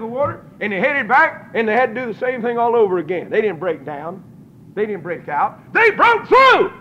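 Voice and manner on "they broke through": high, loud, slow preaching cry; shouted climax. Real, not acted. Preacher rousing the congregation, loud and high. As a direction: lift the whole church with it.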